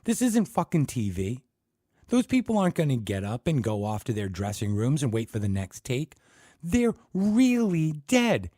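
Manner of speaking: high loud desperate cry; tight. Acted. Ted pleading with the class, high and tight. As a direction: force it out, near breaking. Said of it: "Ted said tightly".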